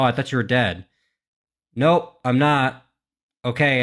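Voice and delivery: ordinary talk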